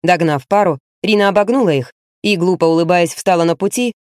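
ordinary delivery, nothing marked

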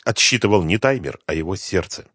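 plain reading voice

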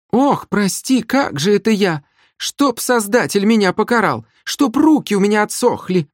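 very high, hammed-up sarcastic lament; mock contrition. A narrator's reading. Sister accused by her brother, exaggerated mock repentance. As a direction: wail a fake apology sarcastically.

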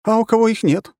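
high, loud outraged succubus voice; indignant excited question. Acted. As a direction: demand it, agitated and offended. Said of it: "возмущённый и возбуждённый вопрос Суккубы".

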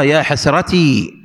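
"حسرتي" slowly ending in long ee; original ya ending. Shown as a demonstration. Teacher giving the original form, "حسرتي" slow with long ee. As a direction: draw out the ee of "حسرتي". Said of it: "هي أصلها".

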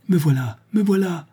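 appeler bien plus aigu, avec élan; la fille veut rassurer son père; cri étouffé. A narrator's reading. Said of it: "voulut-elle crier". (appel crié, voix bien plus haute)

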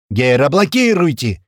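crazed shouting. A narrator's reading. Narrator bursting into the technicians' office, shouting madly. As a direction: shout it like a madman. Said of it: "кричу, как сумасшедший".

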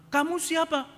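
loud, high-pitched angry challenge. Retold or imitated. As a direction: demand it angrily, playing the indignant passenger. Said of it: "Pak Mukidi marah-marah". (marah-marah, nada tinggi)

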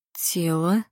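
whispered, rising, bewildered question; bewildered echo. A narrator's reading. Матя asking the policeman back, bewildered whisper. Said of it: "растерянно переспросила Матя".

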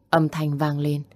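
ordinary delivery

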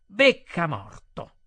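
slow, emphatic insult. A narrator's reading. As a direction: drop from shouting, drag the words out. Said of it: "con voce più bassa".

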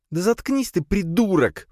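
high, irritated woman's rebuke; snapping at him. A narrator's reading. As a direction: cut him off crossly, pitched high. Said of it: "прикрикнула на него Надя". (сердитый окрик высоким голосом)

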